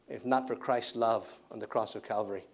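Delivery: ordinary talk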